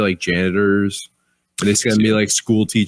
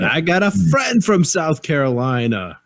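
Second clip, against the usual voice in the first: exclaimed retold remark; 6 semitones higher than usual. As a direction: stretch it out high, imitating someone's eager announcement. Retold remark about a Southern acquaintance.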